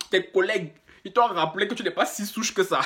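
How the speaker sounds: ordinary delivery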